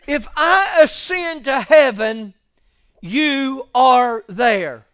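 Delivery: loud, rising sermon voice; loud pulpit preaching; full-force preaching. Acted. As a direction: drive the scripture home.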